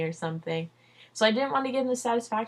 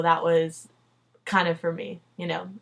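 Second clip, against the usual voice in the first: usual speaking voice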